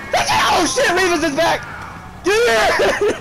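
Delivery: high, panicked yelling at teammates; frantic screaming. Real, not acted. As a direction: shriek urgent orders in total chaos. Frantic escape in the game.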